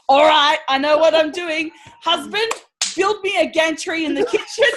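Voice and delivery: loud, high, commanding mum; bossy mum voice. Retold or imitated. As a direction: bark orders like a drill mum. Mum voiced in retold story.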